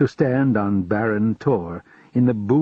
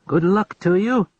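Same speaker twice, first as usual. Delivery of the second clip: high farewell called out across the yard; shouted farewell; 8 semitones higher than usual. Acted. Farmer calling farewell as the carriage drives off, shouted. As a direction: call it after the leaving carriage. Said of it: "shouted the farmer".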